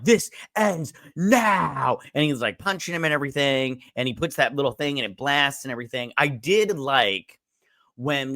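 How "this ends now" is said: heroic Superman line. Retold or imitated. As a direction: play the superhero delivering the final threat. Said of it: "Superman's all like".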